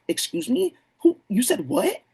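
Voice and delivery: high, rising, startled excuse me; a hammed-up gasp of disbelief; mock shock. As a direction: act offended and startled at the name. Mock reaction to a name.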